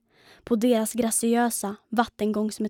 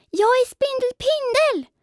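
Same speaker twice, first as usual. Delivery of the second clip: high, loud proud yell; shouted at full voice; 5 dB louder than usual. A narrator's reading. Pindel shouts his name to the flying creature, high loud yell. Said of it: "skrek Pindel för full hals".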